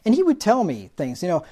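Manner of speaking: usual speaking voice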